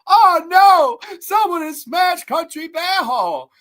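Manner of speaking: high, loud, slow mock panic; hammed-up villain gasping over his smashed model; Christopher Walken. Reviewer imitates the villain finding his smashed model, hammed-up high. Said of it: "He's go".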